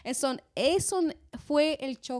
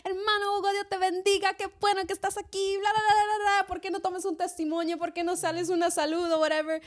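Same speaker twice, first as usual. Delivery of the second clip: higher, louder, gushing church greeting; effusive church welcome; 9 semitones higher, 5 dB louder than usual. Retold or imitated. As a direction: greet the brother like a long-lost friend. Saludo en iglesia hispana. (saludo eclesial efusivo, agudo y fuerte)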